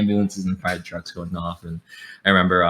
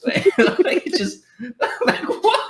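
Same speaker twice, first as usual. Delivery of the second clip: much higher, squeaking through laughter; laughing disbelief; slower than usual. Real, not acted. Reacting to the Pidgin saying, much higher and laughing. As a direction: crack up in disbelief.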